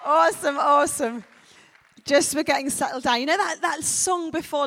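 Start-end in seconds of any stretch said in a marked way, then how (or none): none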